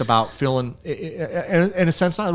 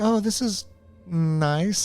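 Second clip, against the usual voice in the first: high, light, politely forced "Oh, this is nice"; polite mock compliment; 7 semitones higher, 5 dB quieter, much slower than usual. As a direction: say it like a guest pretending to like the place. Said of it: "she said something like".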